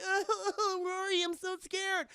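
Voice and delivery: put-on high, frightened little girl voice. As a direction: squeak it out like a scared little kid.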